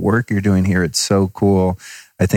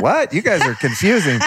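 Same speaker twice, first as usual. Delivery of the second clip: baffled; 8 semitones higher than usual. Retold or imitated. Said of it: "I was like".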